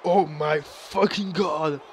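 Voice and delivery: slow, drawn-out shocked exclamation; stunned disbelief. Acted. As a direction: stretch it out in disbelief. Shock at a DDT on the apron.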